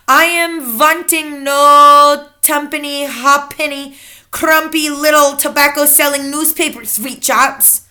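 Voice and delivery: lightly shouted witch leader. A narrator's reading. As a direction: scorn small shops as the Grand High Witch. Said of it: "shouted the Grand High Witch".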